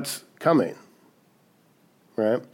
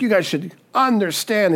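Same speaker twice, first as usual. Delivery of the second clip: higher-pitched, acted exasperation; frustrated Jesus; 6 semitones higher than usual. Father figure to kids not getting it, frustrated. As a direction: scold like a frustrated parent. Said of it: "he's just so frustrated".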